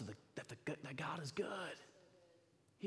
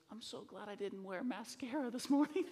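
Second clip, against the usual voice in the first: higher, laughing through tears; tearful daughter; 6 semitones higher than usual. Retold or imitated. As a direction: voice her half crying, half joking. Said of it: "And she's crying".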